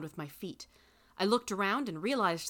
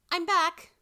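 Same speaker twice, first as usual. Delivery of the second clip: high, loud, excited announcement; exclaimed; 12 semitones higher, 9 dB louder than usual. A narrator's reading. Granddaughter returns to Grandma's house, excitedly exclaiming. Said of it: "I exclaimed".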